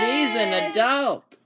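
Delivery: loud, high, drawn-out playful cheer; hammed-up slow, high crowing; mock cheering. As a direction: stretch it out in teasing celebration. Teasing cheer for the graduate.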